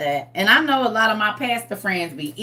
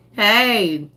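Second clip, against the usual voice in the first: ordinary talk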